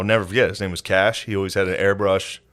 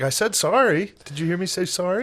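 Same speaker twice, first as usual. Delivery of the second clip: very high singled-out apology; mock insistent apology; 9 semitones higher than usual. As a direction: plead it in a much higher voice. Retells his over-the-top apology to her.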